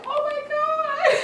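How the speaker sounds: very high, stretched-out squeal; laughing imitation of amazement. Teller replaying her girlfriend's amazement, high laughing squeal. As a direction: squeal it slowly through laughter.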